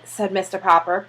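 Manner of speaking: ordinary delivery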